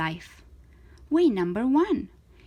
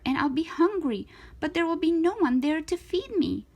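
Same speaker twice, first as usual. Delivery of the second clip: ordinary delivery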